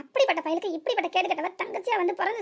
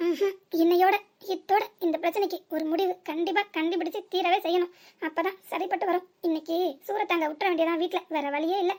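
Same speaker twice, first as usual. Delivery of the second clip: ordinary talk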